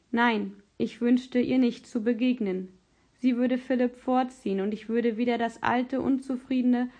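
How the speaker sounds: plain reading voice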